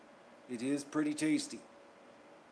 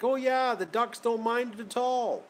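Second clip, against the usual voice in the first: much higher, louder mocking falsetto; mock old lady; 9 semitones higher, 6 dB louder than usual. Vlogger parodying duck farmer's denial, high mock voice. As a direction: parody her breezy denial.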